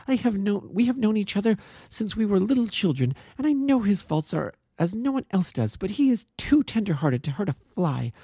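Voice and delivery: put-on high, urgent young woman defending her friend; earnest young woman. A narrator's reading. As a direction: plead with fierce conviction. Miss Turner pleads for James.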